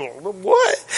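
high, soft squeak of surprise; mock disbelief. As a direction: imitate a stunned onlooker, high and hushed. Imitating shocked churchgoers' reaction.

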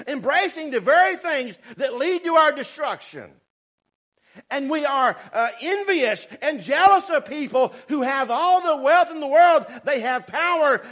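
loud, high-pitched pulpit preaching; full-force preaching. Real, not acted. Preacher warns church against envying the wicked, full-force preaching.

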